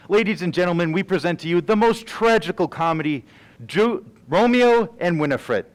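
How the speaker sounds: showman's opening call; grand stage announcement. Acted. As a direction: announce it to the whole house. Announcing the play to the audience.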